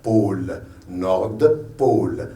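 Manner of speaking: low, slow "Nord", break, "Pôle"; pause between parts. Shown as a demonstration. Teacher demonstrating the compound, pause between parts. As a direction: separate "Nord" from "Pôle", every letter sounded. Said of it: "on fait une pause".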